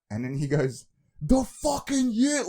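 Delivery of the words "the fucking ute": high, loud, drawn-out retold outburst; mock sleep-talker's angry snap, stretching the words. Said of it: "like, with anger".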